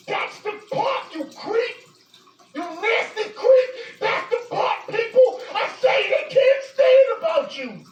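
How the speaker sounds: high, slow, building fury; incensed condemnation, rising in force; real outrage. Real, not acted. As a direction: drive each accusation home, pitch way up. Furious condemnation of the man addressed.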